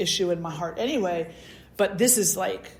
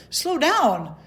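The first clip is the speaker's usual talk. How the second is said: higher, louder mock panic; alarmed plea; 7 semitones higher, 4 dB louder than usual. Begging the cardiologist to wait, higher mock alarm. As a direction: hold up both hands with your voice. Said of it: "And I'm like".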